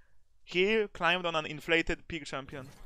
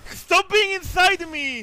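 panicked shout; 7 dB louder, slower than usual. Real, not acted. Enemy on top of her.

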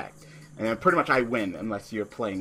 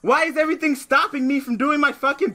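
frustrated rant; 13 semitones higher, 6 dB louder than usual. Real, not acted. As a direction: shriek your disbelief at the game. Key card shuffled away, raging.

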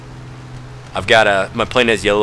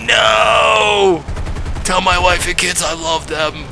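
hammed-up dying pilot, slow; wounded soldier gasping; 7 semitones higher, much slower than usual. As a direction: play a dying soldier's last words. Plane rammed, mock dying words.

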